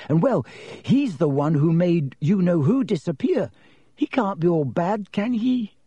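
high, hesitant schoolgirl; uncertain. A narrator's reading. Girl defending Potter to her friends, uncertain. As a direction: rise into a doubtful, pleading question. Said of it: "said Hannah uncertainly".

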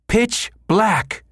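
high, forceful yell. A narrator's reading. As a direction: shout it to make him listen. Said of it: "she yelled".

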